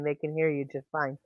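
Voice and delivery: low, very soft, still voiced; hushed. Real, not acted. Host reassures whispering co-host they are audible, hushed.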